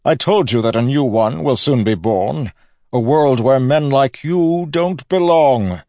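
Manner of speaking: loud, high, projected villain's voice; declaiming villain. A narrator's reading. Villain proclaims a new world to his nephew, loud and high. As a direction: proclaim it grandly, slowing at the end.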